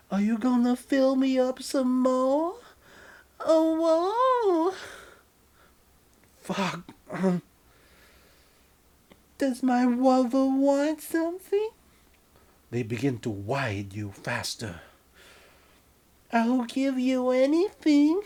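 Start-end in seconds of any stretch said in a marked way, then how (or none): 0.1-11.7 high, loud falsetto lover, slow and swooning; breathy partner. Acted. Narrator voicing the lover in a high hammed-up falsetto. 16.3-18.2 camp it up as the lover in a high falsetto; breathy partner. Acted. Narrator voicing the lover in a high hammed-up falsetto.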